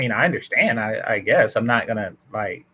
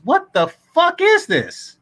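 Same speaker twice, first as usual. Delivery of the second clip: much higher and slowed, stunned outrage; exasperated disbelief; 3 dB louder than usual. Real, not acted. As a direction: throw up your hands in disbelief at what you just read. Reacting to a message read aloud.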